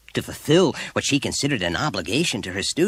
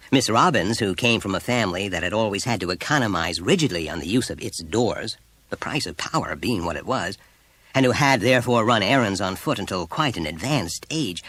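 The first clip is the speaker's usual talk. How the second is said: plain reading voice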